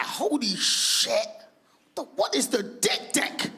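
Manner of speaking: slow, drawn-out amazed question; stunned curiosity. Retold or imitated. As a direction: stretch it out in disbelief. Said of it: "And I was like".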